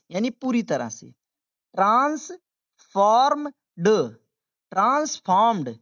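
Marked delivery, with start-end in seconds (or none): 1.8-3.5 broken-up pronunciation model. Shown as a demonstration. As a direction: stretch transformed piece by piece. Teacher breaks word into parts. (transformed टुकड़ों में, धीरे)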